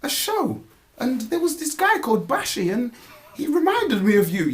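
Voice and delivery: high-pitched auntie voice; imitating auntie. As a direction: pitch it way up like his aunt. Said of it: "even my auntie has come to me and says".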